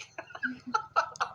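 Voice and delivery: airy, high chuckle; amused laugh. Real, not acted. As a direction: trail off into breathy laughter. Laughs after calling it trippy.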